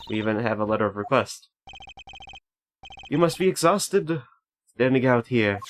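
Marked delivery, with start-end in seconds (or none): 3.1-5.6 high, slow mock-German voice; exaggerated German accent. Gamer voicing the prosecutor leading to the crime scene, hammed-up German accent. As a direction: ham up a German accent, pitched up. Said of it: "I'm not gonna do a Franziska thing".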